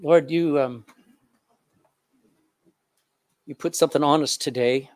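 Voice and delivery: earnest pastoral prayer, swelling and fading. Acted. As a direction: pray aloud over the bowed congregation. Pastor leads closing prayer.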